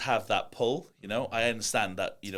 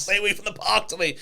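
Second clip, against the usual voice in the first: high, loud mock parental warning with a laugh; 10 semitones higher, 8 dB louder than usual. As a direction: play the worried mum, laughing.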